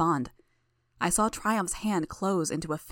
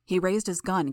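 ordinary delivery, nothing marked

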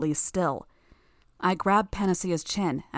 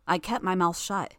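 ordinary delivery

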